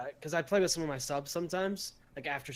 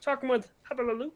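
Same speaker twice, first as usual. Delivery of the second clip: high, bright frog puppet voice; Kermit the Frog voice; 4 semitones higher than usual. Pretend. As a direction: squeeze it up into Kermit. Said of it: "you should have used a Kermit the Frog voice".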